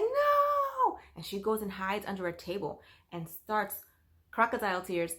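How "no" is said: a sudden high scream of "No!"; child's scream. Retold or imitated. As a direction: shriek it like a panicked little girl. Child panics about being told on.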